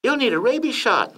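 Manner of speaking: high falsetto wife voice; Lady Bird. A narrator's reading. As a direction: advise your husband in a light falsetto. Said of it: "Lady Bird said".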